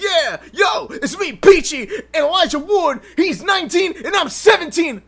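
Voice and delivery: playing Peachy calling out to the crowd; stage announcer mock. Imitating Peachy introducing the band to the crowd, a high hyped-up shout.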